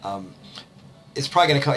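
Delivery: usual speaking voice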